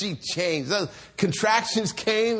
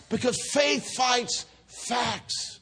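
high, slow, drawn-out declaration; emphatic preaching; 6 semitones higher, much slower than usual. Real, not acted. Preacher to congregation after Isaac's birth, emphatic preaching. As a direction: proclaim it as the climax. Climactic return of sermon point.

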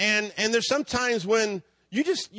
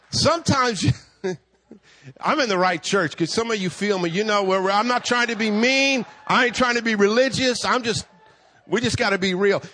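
ordinary delivery

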